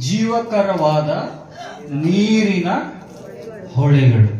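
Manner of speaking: slow, forceful scripture cry; loud proclamation. Acted. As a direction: call out each word separately, loudly. Proclaims the verse again in Kannada. (ಒಂದೊಂದೇ ಪದವನ್ನು ಜೋರಾಗಿ ಸಾರುವುದು)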